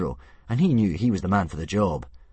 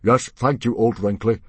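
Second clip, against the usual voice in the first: curt thanks; 4 semitones higher, 7 dB louder than usual. A narrator's reading. Stoic cuts off interrupter.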